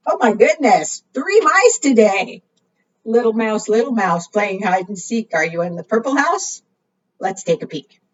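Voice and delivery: ordinary talk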